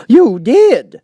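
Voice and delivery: high, loud, slow quavering plea; trembling Hatter. A narrator's reading. Hatter pleads with the King, trembling high and slow. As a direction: beg for mercy, voice shaking.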